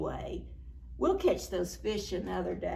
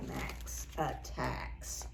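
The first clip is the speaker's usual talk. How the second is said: hushed secret whisper, slow; 11 semitones lower, 10 dB quieter, much slower than usual. Acted. Teacher whispering to the children, hushed and slow. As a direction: lean in and whisper it slowly. Dramatic whisper reading to kids.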